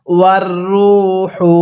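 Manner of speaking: ওয়ার রূহু drawn out; tajweed chant; slow recitation. Shown as a demonstration. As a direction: recite slowly. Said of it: "আমার সাথে পড়ুন".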